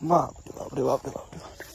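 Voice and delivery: nervous exclamations in the jungle; uneasy, half-muttered alarm; scared. Real, not acted. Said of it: "আমার যেতেও ভয় লাগে". (ভয় পেয়ে বলা)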